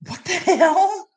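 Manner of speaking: high-pitched laughing what the hell; laughing disbelief. Real, not acted. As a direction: laugh it out, can't believe what you see. Reacting to clip, laughing.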